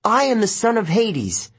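put-on high, forceful young hero's declaration; insistent. A narrator's reading. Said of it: "Nico insisted".

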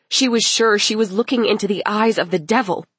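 plain reading voice

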